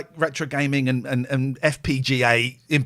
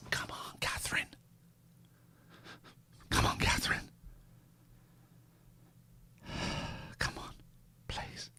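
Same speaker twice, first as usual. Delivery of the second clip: soft whispered pleading; whispering; 17 dB quieter than usual. Real, not acted. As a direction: breathe the plea under your breath. Whispering plea for absent guest.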